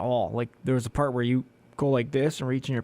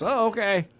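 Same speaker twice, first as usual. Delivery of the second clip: meek high voice; 9 semitones higher than usual. Retold or imitated. Her own meek reply acted.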